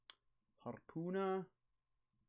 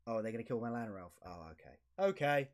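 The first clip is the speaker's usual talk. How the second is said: ordinary delivery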